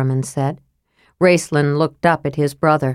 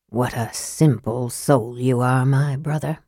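low, soft, understated scorn; quiet contempt, dropped low; scathing; 5 semitones lower, 5 dB quieter than usual. A narrator's reading. Raistlin alone once his brother has gone out, scathing. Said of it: "he remarked in scathing tones".

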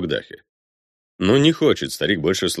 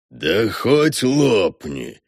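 slow, drawn-out scornful retort; snapping back; 4 semitones higher, much slower than usual. A narrator's reading. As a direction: sneer it back, stretching the words. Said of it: "огрызается невидимка".

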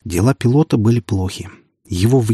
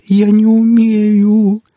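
high, loud, very slow wail; moaning wail; 12 semitones higher, 6 dB louder, much slower than usual. A narrator's reading. Woman unable to give an injection, moaning wail. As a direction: stretch every vowel into a helpless howl. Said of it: "простонала она".

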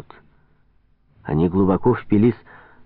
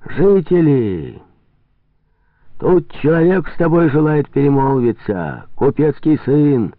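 calling across; 6 semitones higher, 3 dB louder than usual. Acted. Farkov answering the trader from shore, calling out. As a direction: draw the words out so they carry.

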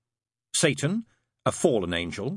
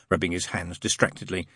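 usual speaking voice

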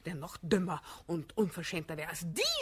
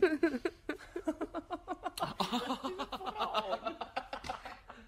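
forced laugh; 5 semitones higher than usual. Acted.

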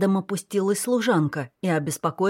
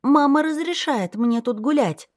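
put-on high, whiny child voice; a spoiled little sister pouting back; capricious little girl; 9 semitones higher than usual. A narrator's reading. Little girl answers her brother capriciously, high and whiny. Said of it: "капризно возразила".

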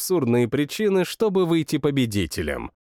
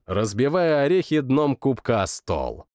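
ordinary delivery

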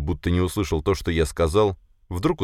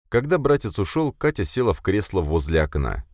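usual speaking voice

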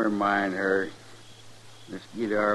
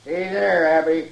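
loud, raised hail; calling out; 8 semitones higher, 6 dB louder than usual. Acted. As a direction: holler across to her. Captor hails woman from distance.

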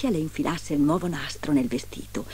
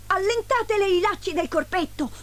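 high-pitched dwarf command; urgent order; 12 semitones higher than usual. A narrator's reading. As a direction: order them in a high, pressing voice. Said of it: "ordinò il terzo".

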